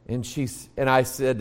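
ordinary talk, nothing marked

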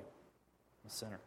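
slow whisper, humbled; whispered confession. Retold or imitated. Confessing to God, a slow humbled whisper. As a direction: breathe it out like a confession.

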